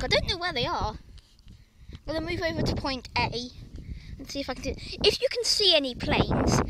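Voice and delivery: usual speaking voice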